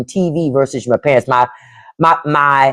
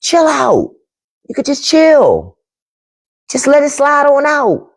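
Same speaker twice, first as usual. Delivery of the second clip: higher-pitched talking-to to oneself; acted self-coaching, pitched up; self-reminder; 8 semitones higher than usual. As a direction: coax yourself to ease up, pitched high. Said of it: "I have to remind myself".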